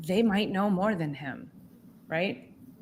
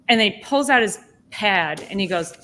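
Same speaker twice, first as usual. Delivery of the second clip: ordinary delivery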